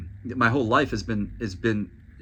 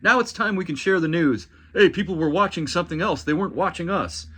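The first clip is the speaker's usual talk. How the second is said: mock anchor; 5 semitones higher than usual. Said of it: "now you're acting like".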